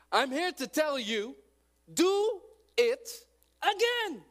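loud, high, rising sermon cry; full-force preaching. Real, not acted. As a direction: preach it hard to the crowd.

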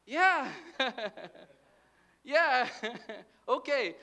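high, loud yeah with forced chuckles; mock laughing along. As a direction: feign getting the joke, laughing between words. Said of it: "I would laugh".

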